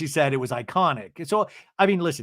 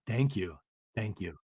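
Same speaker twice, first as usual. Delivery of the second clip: low, soft imitated thank-you; subdued thanks; 8 semitones lower, 6 dB quieter than usual. Recapper as Lisa accepting Whitney's apology, quiet and low. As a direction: drop the voice low and murmur it.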